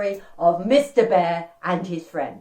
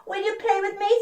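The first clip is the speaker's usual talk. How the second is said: high-pitched hopeful question; eager storybook invitation; 11 semitones higher than usual. Acted. Mister Bear asks Dog to play, high-pitched. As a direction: lift the voice right up to ask.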